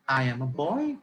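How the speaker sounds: put-on "boy" rising sharply at the end; question intonation. Shown as a demonstration. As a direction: ask it, lifting the pitch on "boy". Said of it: "there's a huge difference between".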